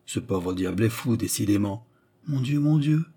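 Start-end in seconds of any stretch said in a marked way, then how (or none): 2.3-3.1 high, plaintive dismay; mildly anguished little appeal; murmured dismay. A narrator's reading. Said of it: "murmura Oliva".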